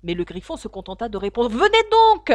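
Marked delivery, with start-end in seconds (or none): 1.5-2.3 loud high urgent call on the run; shouting while running. Acted. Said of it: "en courant de plus belle".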